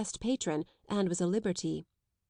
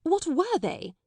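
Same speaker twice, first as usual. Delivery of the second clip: higher, pressing; alarmed headmistress pressing, higher; urgent; 9 semitones higher, 4 dB louder, slower than usual. Acted. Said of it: "she said".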